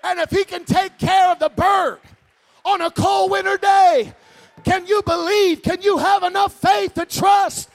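loud, climbing preacher's shout; preaching at full force. Acted. Preacher rousing the congregation about God's care, shouting. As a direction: build it up and shout it out to the church.

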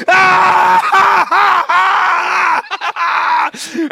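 loud, high mocking "ha ha" like a jeering crowd; imitated crowd laughter. Teenagers in the crowd laughing at the freshman, loud mock guffaw. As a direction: ham up a thousand teenagers cackling. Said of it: "teenagers in the crowd going".

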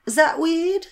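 high-pitched imitation of the nosy neighbour; neighbour impression. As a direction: pipe up over the fence, imitating her. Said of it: "she was all like".

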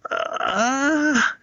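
high, thin mock yell; imitating a yell. Boy yelling on command for the coach, a high mock yell. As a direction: squeak out a timid mock yell. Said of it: "let me hear you yell".

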